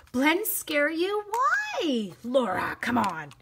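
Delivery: mock surprise. Teasing a viewer's fear.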